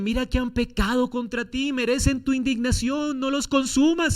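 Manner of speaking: fervent intercession; pleading prayer. Acted. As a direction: intercede earnestly for your children.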